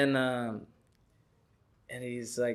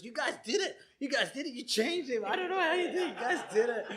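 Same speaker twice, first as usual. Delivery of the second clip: high-pitched excited congratulation; squeaky, hammed-up astonishment; amazed onlooker; 10 semitones higher than usual. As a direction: imitate a man marvelling, voice way up. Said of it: "he was running up there, he goes".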